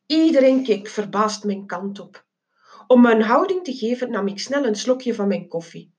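ordinary delivery

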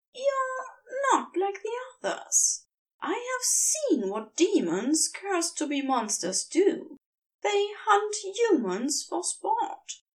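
high, earnest angel voice; Aziraphale's voice. A narrator's reading. The angel tells the demon he is different, high and earnest. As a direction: lift the voice high and keep it gentle.

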